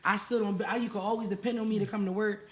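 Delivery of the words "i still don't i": ordinary delivery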